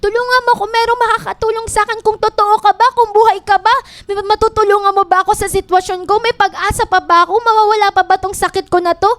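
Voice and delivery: high, pleading cries to God, pushing hard; desperate crying out. Acted. Hurting person crying out to the Lord, desperate shout. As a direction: call out to heaven like someone at the end of their rope. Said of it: "Sumisigaw sila kay Lord".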